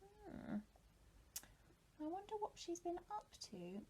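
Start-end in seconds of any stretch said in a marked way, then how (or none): none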